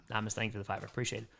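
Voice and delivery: soft unvoiced whisper; whispered thanks. Real, not acted. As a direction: whisper the thanks so the dog stays calm.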